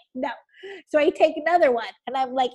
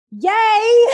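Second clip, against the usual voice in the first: loud, high, laughing yay; hammed-up hooray through a laugh; mock cheer; 7 semitones higher, 10 dB louder than usual. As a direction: cheer it loudly, half laughing.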